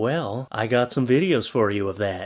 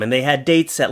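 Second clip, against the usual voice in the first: ordinary delivery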